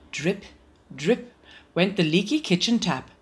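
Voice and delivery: usual speaking voice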